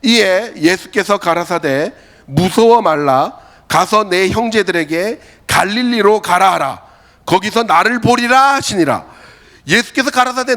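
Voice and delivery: slow, loud unison recitation; a solemn, slow unison Bible reading; congregation scripture reading. Real, not acted. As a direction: read the verse slowly together. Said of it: "다 같이 한번 읽어볼까요? 시작".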